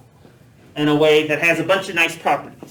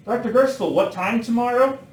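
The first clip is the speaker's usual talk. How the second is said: higher-pitched mock student question; imagined student; 7 semitones higher than usual. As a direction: put on an eager student's higher voice. Voices a student's question to himself.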